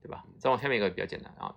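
usual speaking voice

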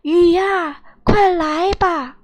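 loud, high summoning cry; storybook hero calling out at full voice; big shout. A narrator's reading. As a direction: shout up to call down the rain. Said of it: "赤松子又大叫".

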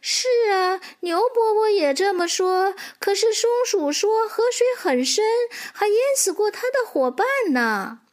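put-on very high young foal voice; little horse. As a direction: explain to mother in a high child's voice. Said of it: "小马说". (小马用又高又稚嫩的声音解释)

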